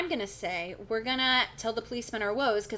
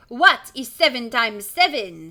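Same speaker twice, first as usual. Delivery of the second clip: slow, loud French quizzing; slow French-accented teacher's question; 2 semitones higher, 9 dB louder, slower than usual. Acted. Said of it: "She's French as well".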